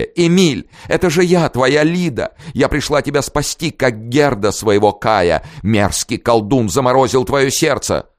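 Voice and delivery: high, theatrical plea; melodramatic pleading. A narrator's reading. Princess melodramatically begs her lover, high and theatrical. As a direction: plead like a fairy-tale heroine.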